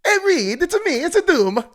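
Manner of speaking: high, loud Italian plumber voice; big cartoon Mario bit. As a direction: shout it like Mario himself. Doom in Mario voice.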